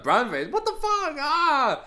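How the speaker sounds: high, loud, drawn-out holler; mock outraged yell. Acting out the brown face reaction, mock outraged yell. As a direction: cry out in hammed-up horror, ending on a scream.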